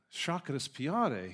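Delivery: slow "Shakespeare" as "shah-ka-spee-AH-day"; Italian-sounding pronunciation. Retold or imitated. Student repeating his favorite to a puzzled librarian, "Shakespeare" as "shah-ka-spee-AH-day". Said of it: "That sounds Italian".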